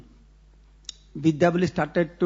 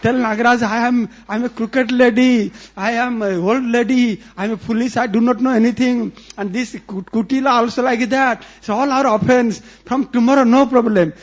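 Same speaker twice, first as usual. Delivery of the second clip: loud, higher, groveling apology; old woman begging forgiveness, louder and higher; frantic pleading; 4 semitones higher, 5 dB louder than usual. Acted. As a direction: grovel in panic to appease the snake. Jatila humbling herself loudly.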